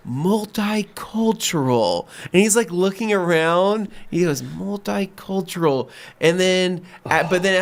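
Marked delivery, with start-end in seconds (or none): 0.1-2.1 very slow, high, drawn-out word; mock-impressed customer voice; imitated dawning understanding. Customer sounds out the new word. 4.4-5.9 very slow, high, drawn-out word; mock-impressed customer voice; imitated dawning understanding. Customer repeats the new word.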